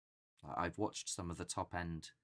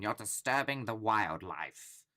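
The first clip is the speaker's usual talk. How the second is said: loud, slow, higher mock complaint; protesting animal activist; 4 semitones higher, 8 dB louder, slower than usual. Animal activists objecting to the screaming, loud mock protest. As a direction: scold like an outraged activist. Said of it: "animal activists going".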